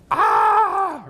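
high, drawn-out mock scream of frustration; exasperated yell. Preacher imitating cornered Pharisees who can't win, exasperated mock yell. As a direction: cry out as if you just can't win.

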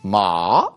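"ma" rising steeply from low; tone demonstration. Shown as a demonstration. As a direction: start "ma" deep, then swoop up. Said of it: "all the different ways you could say ma".

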